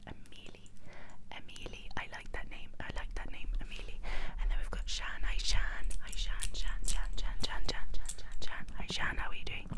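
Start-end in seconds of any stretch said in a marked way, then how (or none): none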